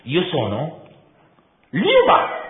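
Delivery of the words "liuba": "Liuba!" cracked out high and loud like a whip; proud name reveal. A narrator's reading. She proudly announces her name "Liuba!" with a whip-crack tongue. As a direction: snap the name "Liuba!" crisply, no hesitation. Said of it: "pronunciato quel difficile nome senza esitazioni, fioccando la lingua come una frusta".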